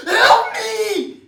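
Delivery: very high, very loud screaming plea; crying for help. A narrator's reading. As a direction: scream it like a bird in the jaws. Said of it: "he cried".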